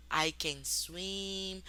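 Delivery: very slow, deliberate English example; very slow model sentence. Shown as a demonstration. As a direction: draw each word out for learners.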